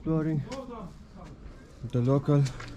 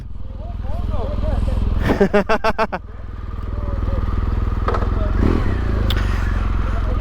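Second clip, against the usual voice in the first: long real laugh; 8 semitones higher than usual. Real, not acted. Laughs after joke.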